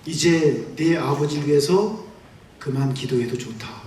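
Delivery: low, slow gentle voice of God; a hint of a solemn divine voice; God releasing him from prayer, low and slow; lightly voiced God. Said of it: "하느님께서 저한테".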